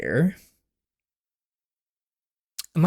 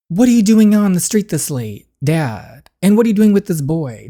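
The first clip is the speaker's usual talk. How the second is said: louder, higher furious demands; dad confronting his daughter and the boy, furious; angry father; 5 semitones higher, 6 dB louder than usual. Acted.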